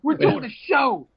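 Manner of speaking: slow, high, indignant showman; pompous outburst. Retold or imitated. Comic demanding directions, insisting he has a show to do. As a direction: draw it out like an offended star. Retelling own outburst, laughing.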